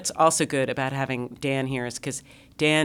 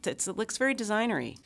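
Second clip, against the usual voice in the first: ordinary talk, nothing marked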